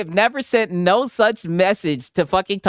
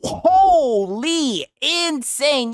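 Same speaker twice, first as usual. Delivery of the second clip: hyped slow exclaim; 5 semitones higher, much slower than usual. Real, not acted. Hyped reaction to donation.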